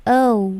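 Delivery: "O" gliding into a W, "ou"; English diphthong O. Shown as a demonstration. Teacher teaching English vowel, gliding O. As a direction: round the "O" off towards "u". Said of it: "orang Inggris mereka tidak bilang O".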